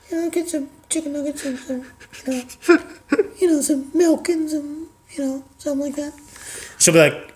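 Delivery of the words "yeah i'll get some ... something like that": much higher, soft and barely there; tiny meek voice. Acted. Meek wife ordering nuggets, tiny soft voice. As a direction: squeak it out timidly.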